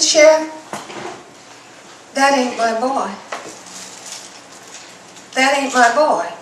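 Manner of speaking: loud, insistent mother refusing the truth; firm denial. Acted. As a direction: plant your feet and deny it loudly. Mother denies dead body is son.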